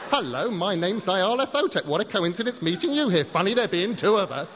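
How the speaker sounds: high, loud mocking voice; mock introduction. Wilf mocking the idea of a namesake, high and loud. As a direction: sneer it out as a silly stranger. Mocking imagined other Nyarlathotep.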